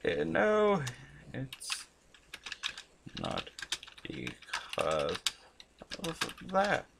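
slow, word by word with long pauses; broken-up, distracted sounding-out; typing along. Real, not acted. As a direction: dictate it haltingly as you type. Types the answer word by word.

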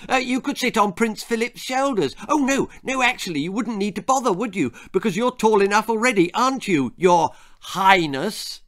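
put-on high, excitable boyish babble; loud interrupting boy. A narrator's reading. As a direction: prattle on loudly and high. Jack keeps shouting to Queen.

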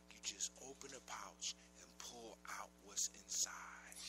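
hushed secret whisper. Acted. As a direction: whisper it so nobody hears. Secret instruction to the prince.

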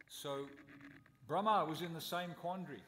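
normal talking voice

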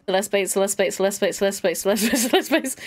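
rapid-fire repeats running together; fast repeats, tumbling into laughter; tongue-twister speed. Shown as a demonstration. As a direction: race through it like a tongue-twister. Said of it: "Say that ten times fast".

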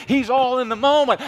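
ordinary talk

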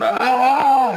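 high, loud drawn-out "aah" scream; acted scream. Prankster faking a hand injury, screaming. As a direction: let out a long fake scream of agony.

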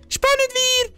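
high, bright excited call. Real, not acted. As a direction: sign off with a burst of excitement. Excited outro.

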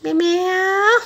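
put-on mother showing baby how to say "Meo meo"; baby-talk meow. Shown as a demonstration. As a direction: model the word for the baby, way up high. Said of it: "con gọi meo meo đi".

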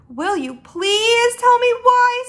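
high, loud, drawn-out plea; exasperated pleading. Acted. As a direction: stretch the plea in frustration. Sophie begs for an explanation.

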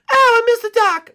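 loud squeaky cry; high yelp. Acted. Character yelps, loud and high.

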